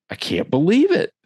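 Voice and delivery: livid disbelief. Retold or imitated. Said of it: "I'm livid".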